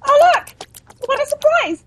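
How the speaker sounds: mock surprise.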